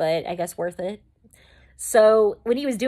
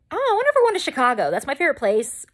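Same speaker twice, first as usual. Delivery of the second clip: high, rushed burst of excitement; excited squeal of anticipation, easing at the end; excited guess; 7 semitones higher, 4 dB louder, faster than usual. Retold or imitated. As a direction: blurt it out high and fast, thrilled. Retells her hopeful gut feeling.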